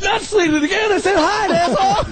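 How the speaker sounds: very high-pitched mock panic; nervous, flustered. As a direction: imitate a flustered slate man, squeaking high. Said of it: "he gets all nervous".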